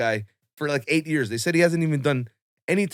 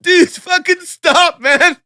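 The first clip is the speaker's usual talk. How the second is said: high, loud, slow blubbering plea; mock bawling plea; 12 dB louder, much slower than usual. To the crying roommate at five in the morning, a mock bawling high plea. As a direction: beg him to stop through hammed-up sobs. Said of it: "We're crying our eyes out".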